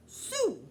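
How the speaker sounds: "Sue" said high and loud for shoes, sh turned to s; squeaky little-kid "Sue", no sh sound; toddler lisp. Retold or imitated. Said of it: "She hasn't learned to make the sh sound yet".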